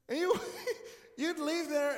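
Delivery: breaking into laughter; laughing. Real, not acted. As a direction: laugh through the words.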